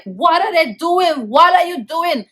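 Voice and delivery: high, drawn-out distressed cry; retold bawling. Child seeing her cousin beaten, high wailing protest. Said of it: "I start to bawl".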